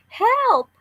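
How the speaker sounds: high, loud cry for help; trapped girl shouting up for help; yelled for help. A narrator's reading. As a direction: call out from the bottom of a hole. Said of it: "she yelled".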